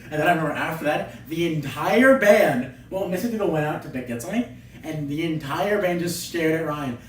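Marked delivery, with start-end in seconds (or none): none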